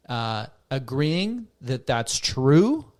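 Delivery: ordinary talk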